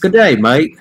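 a light hammed-up Australian accent; mock Australian accent. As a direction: toss it off in a cheesy Australian accent.

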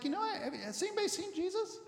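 high, fast voice of a parent asking around; worried parent. Retold or imitated. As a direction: play the mother casually asking the relatives. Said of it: "And they're like".

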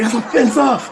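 high, loud hammed-up protest; mock offended. Audience objecting to the comedian, loudly mock offended. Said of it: "Y'all be like".

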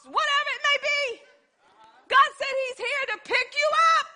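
high, loud, shouting sermon; preaching at full force. Real, not acted. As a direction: drive it home to the congregation.

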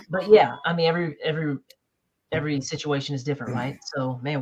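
usual speaking voice

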